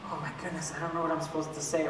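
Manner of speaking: quick, hushed flustered panic; mock-panicked parent, rushed and quiet. Parents panicking at kids' question.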